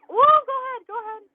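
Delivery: a high, loud startled whoa, then letting it go. Real, not acted. Driver startled by something, yelping then letting it pass. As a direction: jump at the surprise, then wave it through.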